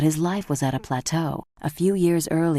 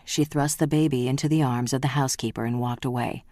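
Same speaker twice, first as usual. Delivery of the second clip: plain reading voice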